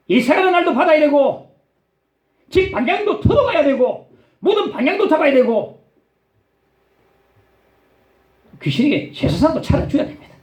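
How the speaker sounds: high-pitched, impassioned sermon delivery; ringing pulpit exhortation; forceful preaching. Real, not acted.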